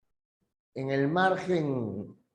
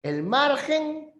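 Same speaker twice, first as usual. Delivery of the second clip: "el margen" much higher and slow; stressed key term; 10 semitones higher, slower than usual. Shown as a demonstration. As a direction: lift "el margen" high and draw it out.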